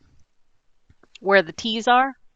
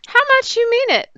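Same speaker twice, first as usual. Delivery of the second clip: excited; 11 semitones higher, faster than usual. Acted. DM demands proof of love.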